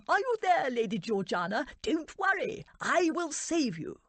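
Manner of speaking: a fussy lord calling out to rescue her; Lord Towsett calling out. A narrator's reading. A lord calls into the dark room, high and loud. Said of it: "Lord Towsett called".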